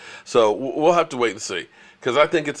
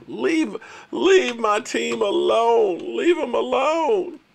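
mock pleading; 9 semitones higher, much slower than usual. Real, not acted. Begging rival fans to go.

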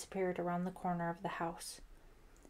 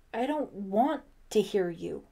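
loud, angry protest; angry cry; 6 dB louder than usual. A narrator's reading. Ellie refusing to listen to Brian, angry cry. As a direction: refuse him with heated emphasis. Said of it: "Ellie cried angrily".

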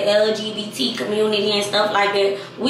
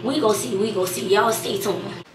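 ordinary talk

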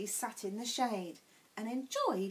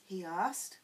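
plain reading voice

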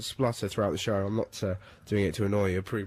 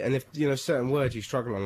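usual speaking voice

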